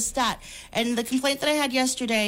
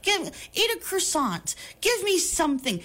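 pleading, much higher-pitched rant; exasperated rant; 9 semitones higher than usual. Real, not acted. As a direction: beg him in disbelief to do anything French. Exasperated rant at absent actor.

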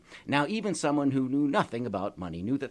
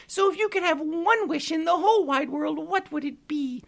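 grateful pixie; 6 dB louder than usual. Acted. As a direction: thank him like a tiny delighted fairy. Pixie figure held voice.

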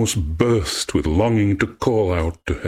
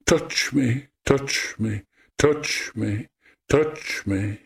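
higher, hushed, fading repeats; sobbing echo, understated; 6 semitones higher, slower than usual. A narrator's reading. Rejected nymph's voice echoing among the mountains, understated sobbing. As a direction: let it lurch and fade like a tearful echo. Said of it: "Echo collapsed in sobs".

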